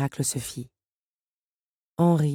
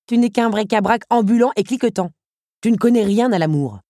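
louder, higher contempt; mocking villain; 6 semitones higher, 6 dB louder than usual. Henri mocks Otto's love, contemptuous. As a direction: sneer down at the toy.

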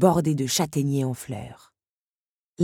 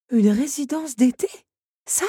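breathed in disbelief; 8 semitones higher, 4 dB louder than usual. A narrator's reading. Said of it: "souffla Belle, incrédule".

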